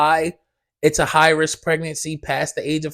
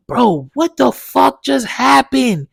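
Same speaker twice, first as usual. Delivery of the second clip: higher, slow, disbelieving cry; stunned disbelief; 7 semitones higher, slower than usual. Retold or imitated. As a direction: replay the shock of seeing it. Retelling shock in dream.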